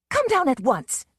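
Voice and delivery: indignant order. Acted. Susan losing patience with the panther, indignant loud command. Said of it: "Susan was waxing indignant".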